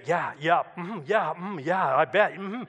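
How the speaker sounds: mumbled listening noises; tracking along. Shown as a demonstration. Man trying not to offend his neighbour, tracking along. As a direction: run through habitual yeahs and mm-hmms. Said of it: "how do I say I'm tracking with someone".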